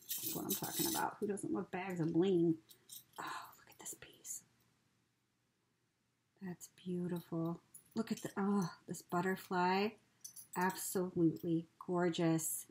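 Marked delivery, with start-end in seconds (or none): 3.2-7.6 hushed, breathy awe; whispering. Real, not acted. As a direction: whisper it in wonder. Admiring a piece softly.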